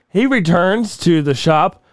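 ordinary talk

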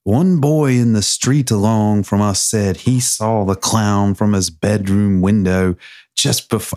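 put-on slow Southern-bent take on a Scottish accent. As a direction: read as a Scot who learned a country accent. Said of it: "learn to speak with my accent".

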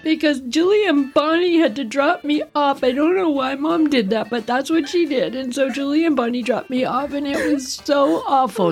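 high, whiny mock sobbing tale; crying. Crying child explaining to her sister, mock tearful. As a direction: tell it like a tearful ten-year-old. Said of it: "And I'm crying".

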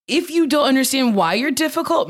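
usual speaking voice

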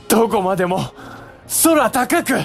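excited calling. Acted. Brother watching dove fly.

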